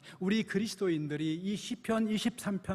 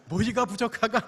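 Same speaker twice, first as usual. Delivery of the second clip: loud Pyongan dialect, higher pitch; 4 semitones higher, 7 dB louder than usual. Retold or imitated. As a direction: relish the Pyongan line once more. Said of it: "평안도 사투리가 제일 마음에 와닿는".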